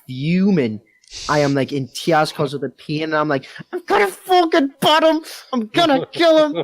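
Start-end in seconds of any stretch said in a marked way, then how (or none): 3.7-6.6 high, loud mock-furious ranting; hammed-up drunken fury in a high voice; raging threats. Retold raging threat in hospital.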